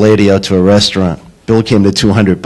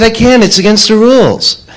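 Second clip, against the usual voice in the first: put-on high, prim counter-girl voice; girlish clerk voice; 12 semitones higher than usual. Clerk at Friendly's refusing the odd order in a high girlish voice. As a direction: sound like a rule-bound teen clerk, much higher. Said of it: "She said".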